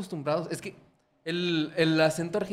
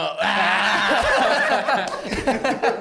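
laughing; 4 semitones higher, 6 dB louder than usual. Real, not acted. Reacting to the gecko imitation.

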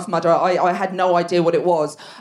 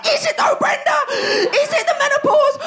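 much higher, shouting back; mock furious shout. Retorting to husband on the sofa, high indignant shout. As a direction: fire it back at him, outraged.

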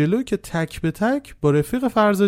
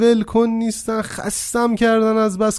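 high, louder exasperated excuse; abrupt defensive switch; 9 semitones higher, 4 dB louder than usual. A narrator's reading. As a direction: plead innocence as the worn-out peacemaker. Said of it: "یهو کانالش عوض شد".

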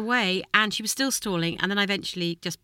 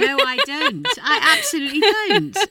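high, loud laughing protest; laughing denial; 10 semitones higher, 5 dB louder than usual. Real, not acted. Mum to daughter, laughing denial. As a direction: deny it while cracking up.

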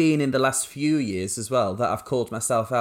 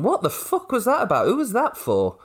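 disbelieving laugh; 11 semitones higher than usual. Retold or imitated. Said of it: "looked back and gone".

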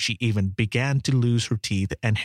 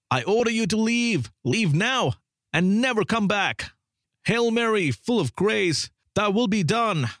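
raised, insistent exorcist's orders; the priest's last insistent commands to leave, higher; commanding priest; 7 semitones higher than usual. Acted. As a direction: drive the demon out, urgent and solemn. Narrator voices priest's final commands.

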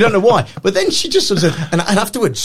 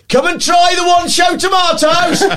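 mock market shout; 11 semitones higher than usual. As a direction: bellow it like a trader hawking tomatoes. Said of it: "he started shouting".

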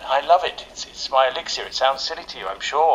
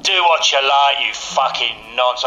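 slow, louder London gangster defiance; London gangster accent; 5 dB louder, slower than usual. Acted. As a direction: drag it out in a hard London accent. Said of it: "work on your accent".

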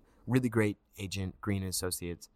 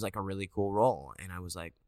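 normal talking voice

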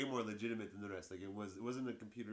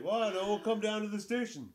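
higher, louder dismissive officer voice; hammed-up indifferent cop telling him to come in; mock lazy cop; 9 semitones higher, 8 dB louder than usual. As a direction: brush him off like a bored dispatcher. Mocks lazy police officer.

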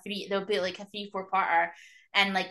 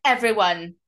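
Whitney; 2 semitones higher, 10 dB louder than usual. Retold or imitated. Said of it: "Whitney swings around the corner, is like".

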